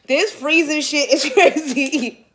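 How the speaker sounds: high, drawn-out words breaking into laughter; real laughter bursting through the words; laughing. Real, not acted. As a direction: crack up mid-sentence. Cracking up after a freeze prank.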